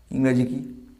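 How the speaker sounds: normal talking voice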